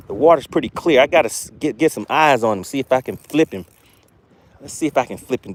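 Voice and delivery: normal talking voice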